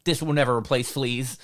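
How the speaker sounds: put-on loud stubborn old-timer; grumpy old guy. Old guy refusing TV over fleas, loud stubborn old-man voice. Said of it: "there's a fucking old guy's like".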